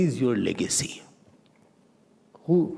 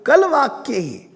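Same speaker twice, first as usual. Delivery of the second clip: emphatic quote; 14 semitones higher, 7 dB louder, much faster than usual. Shown as a demonstration. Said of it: "So बाल".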